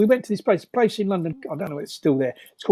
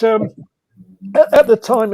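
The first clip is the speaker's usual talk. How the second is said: ordinary talk, nothing marked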